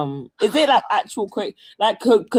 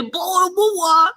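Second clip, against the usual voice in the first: mock British; 9 semitones higher than usual. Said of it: "you hear British people and then you go".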